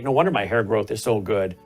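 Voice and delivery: normal talking voice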